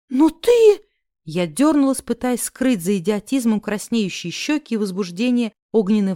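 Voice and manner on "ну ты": high, loud indignant outburst; scandalized, high-voiced protest; outraged. A narrator's reading. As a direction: burst out in offence, pitch leaping. Heroine outraged at indecent offer.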